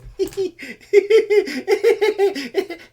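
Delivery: high, repeated tittering giggle; imitated giggle. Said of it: "she's over there like this".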